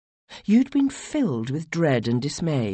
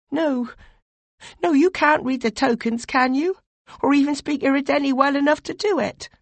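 higher, louder, urgent pleading; anxious young advisor; 12 semitones higher, 3 dB louder than usual. Acted. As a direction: press your lord with dread and worry. Voiced "you" character questions Dipeju.

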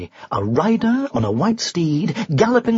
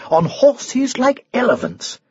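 usual speaking voice

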